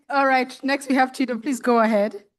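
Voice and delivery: ordinary talk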